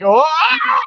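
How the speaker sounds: mimicking an actor's over-the-top outburst, pitched high; a big, high, yelled gasp like a ham actor's face; hammed-up actor's cry. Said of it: "appreciate acting more than".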